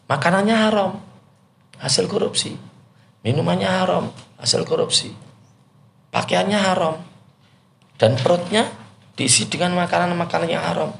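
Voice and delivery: haram stressed high on each clause, falling at the end; correct intonation. Shown as a demonstration. Preacher demonstrating the right rhetorical rise on haram. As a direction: lift each "haram" high then let it fall. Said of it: "Yang betul".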